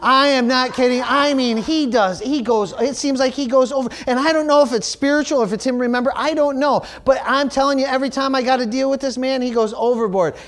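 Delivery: high-pitched, emphatic exclaiming; worked-up insistence. Real, not acted. Pastor telling congregation the man goes overboard, worked-up insistence. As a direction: insist in real exasperated amazement.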